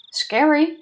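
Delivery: high, loud, playful fright; mock scared. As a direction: squeal it in fake fear. Mock fear at threat.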